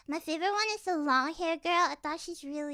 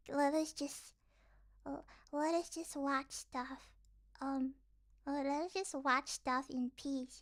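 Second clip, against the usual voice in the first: soft, much quieter appeal; subdued plea; 4 semitones lower, 9 dB quieter than usual. Pretend. Fan asking critics to let them watch, subdued plea. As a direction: ask meekly, keeping the volume down.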